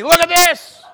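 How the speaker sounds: preacher mocks bragging to neighbor about chores, loud and high; mock calling to neighbor. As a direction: show off to the neighbor over the fence.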